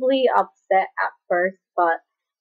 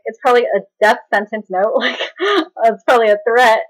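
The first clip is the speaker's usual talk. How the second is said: laughing, overdramatic dread; being dramatic; 4 semitones higher, 6 dB louder than usual. Retold or imitated. Granddaughter guessing about dad's note, overdramatic. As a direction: re-enact your own melodrama, louder on the last words. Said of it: "I was being so dramatic".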